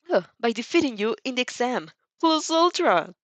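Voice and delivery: a fired-up rival promising to win; hammed-up hot-blooded boy on the phone; passionate boy. Inasa voiced by reader.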